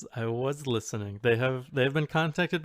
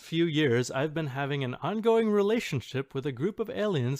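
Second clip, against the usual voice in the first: lightly higher, bright poster voice reading a confession; mock earnest poster; 5 semitones higher, 4 dB louder than usual. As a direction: read it like a sincere online poster. Reading post in persona voice.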